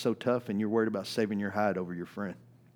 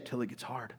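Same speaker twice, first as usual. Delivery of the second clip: dry aside; 9 dB quieter than usual. Acted. Punchline after mock pledge.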